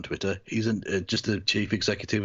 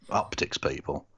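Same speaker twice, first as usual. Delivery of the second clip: ordinary delivery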